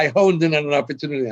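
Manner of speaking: usual speaking voice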